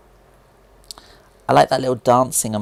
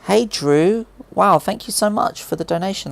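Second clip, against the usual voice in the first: higher, louder bright thank-you to a donor; thrilled streamer thanking a donor; excited thanks; 8 semitones higher, 4 dB louder than usual. Real, not acted. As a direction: gush your thanks to the donor.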